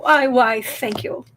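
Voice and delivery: ordinary delivery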